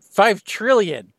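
slow, loud disbelieving repeat; incredulous. Real, not acted. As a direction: echo the price, stunned. Repeating absurd price in disbelief.

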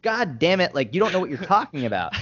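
high-pitched, fed-up retort; exasperated outburst. Retold or imitated. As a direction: lose patience with them, pitch jumping up. Retold argument, losing patience.

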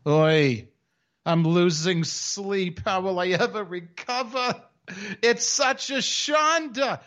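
high, drawn-out Yiddish-style kvetch; complainer. Acted. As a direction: wail it slowly like a hammed-up complainer. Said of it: "you just reenacted yourself".

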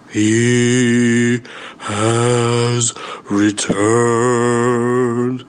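very slow, low, stretched words; ominous announcement. Acted. Narrator repeating the dreaded return. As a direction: drag out each word with dread.

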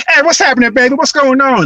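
high, loud, exuberant greeting; dad's-side relatives' talk. Retold or imitated. Relatives greeting at the family's house, loud high jive greeting. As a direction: imitate relatives hollering a big hello. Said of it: "you hear people talk like".

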